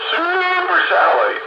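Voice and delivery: hammed-up call of the radio man; mock Pete catchphrase. Teasing friends, crying out Pete's line in a high mock voice. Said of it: "sit over there and scream".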